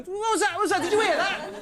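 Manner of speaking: high yappy dog voice, startled; a dog hearing the whistle, puzzled; talking dog. Acted. Dogs across the neighbourhood hearing the whistle, high yappy voice. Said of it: "there's dogs going".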